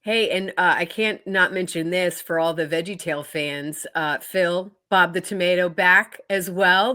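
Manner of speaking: ordinary delivery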